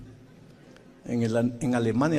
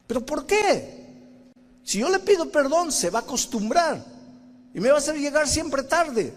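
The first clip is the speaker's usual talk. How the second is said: put-on high, loud childish whine; whiny protest; 13 semitones higher, 3 dB louder than usual. Preacher whining back at the Spirit, high childish protest.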